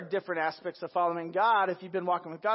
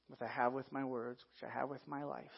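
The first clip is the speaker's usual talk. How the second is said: ordinary talk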